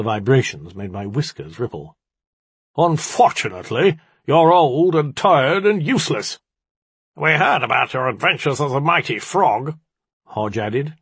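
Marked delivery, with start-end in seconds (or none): 2.8-6.4 loud, raised, thick-voiced dismissal; booming, basalt-thick. A narrator's reading. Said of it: "His basalt-thick voice boomed". 7.1-9.8 raised, amused jeer without real laughter; chuckling mockery. A narrator's reading.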